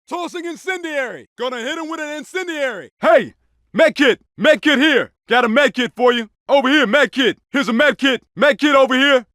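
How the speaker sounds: high shouted callouts to teammates; combat callouts. Acted. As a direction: call it out over the gunfire. Game soldier shouting item callouts.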